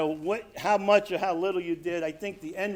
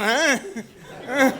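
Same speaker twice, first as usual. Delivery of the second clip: sudden louder, higher "huh"; startled response; 7 semitones higher, 5 dB louder than usual. Retold or imitated. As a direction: jerk to attention, as if just called. Acts out hearing the dope call.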